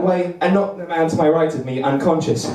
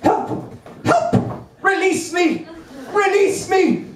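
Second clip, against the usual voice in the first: very high hammed-up cries; mock cries for help; 12 semitones higher, slower than usual. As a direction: wail for rescue, overplayed. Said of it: "stuck on fence spike".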